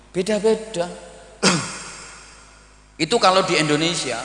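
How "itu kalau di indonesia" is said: ordinary talk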